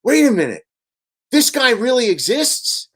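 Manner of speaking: a startled priest who can't believe it; astonished priest. Retold or imitated. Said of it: "looks at my cousin and goes".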